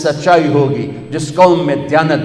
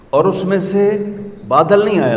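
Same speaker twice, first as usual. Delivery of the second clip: ordinary delivery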